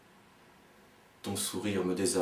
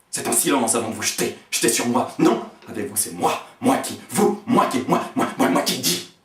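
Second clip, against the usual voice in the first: rushed and rising into a near-shout; frantic build; 3 semitones higher, 8 dB louder, faster than usual. Acted. Speaking to the silent other, frantic and building to a shout. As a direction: spiral up into panic, words tumbling.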